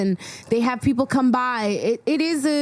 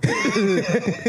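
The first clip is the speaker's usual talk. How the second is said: high laugh. Real, not acted. Laughs at joke.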